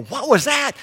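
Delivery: high, alarmed question; jolted awake by a noise; startled. Acted.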